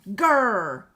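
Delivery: a short, loud mock growl; a playful grr after gluing it upside down; frustrated growl. Real, not acted. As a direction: growl at the mistake. Frustrated at a crafting mistake.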